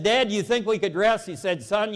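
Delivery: normal talking voice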